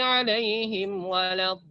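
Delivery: alaihim waladdallin with a clipped madd; too short madd. Shown as a demonstration. (madnya terlalu pendek, salah)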